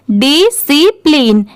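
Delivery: Discipline slowly as "di-see-pleen"; slow, separated syllables in discipline; syllable-by-syllable model. Shown as a demonstration. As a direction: break discipline into three slow parts. Teacher sounds out the word slowly.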